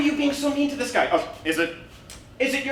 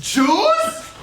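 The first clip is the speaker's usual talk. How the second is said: loud, high outburst; sudden raised-voice reaction; startled shout; 10 semitones higher, 5 dB louder than usual. Acted. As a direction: shout it as a shocked question. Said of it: "He shouts at any mention".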